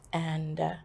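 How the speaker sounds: ordinary talk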